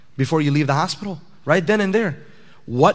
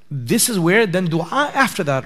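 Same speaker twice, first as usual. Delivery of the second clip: ordinary talk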